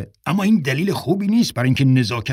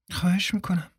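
whisper; 7 semitones higher, 6 dB quieter than usual. Acted. April answering softly.

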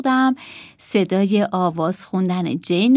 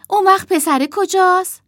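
high, louder demanding question; interrogating child; 11 semitones higher, 6 dB louder than usual. A narrator's reading. Emma questions her sister about the boy, demanding. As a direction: press for an answer as a girl.

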